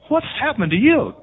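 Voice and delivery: high, loud, slow stunned disbelief; astonished mother. Retold or imitated. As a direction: gape at the son and cry it out, baffled. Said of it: "she looks at him with her mouth wide open and said".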